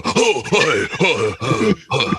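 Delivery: lightly voiced British hey hey; mild mock British greeting; British gent. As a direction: greet it like a jolly English squire. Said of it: "this British guy would walk up and go".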